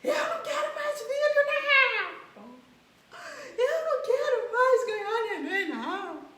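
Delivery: high, loud mock wail of a mother giving birth; woman in labour. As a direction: cry out like a woman mid-contraction, half laughing. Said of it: "Aí ela pensa assim".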